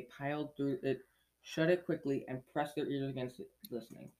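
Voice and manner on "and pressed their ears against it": usual speaking voice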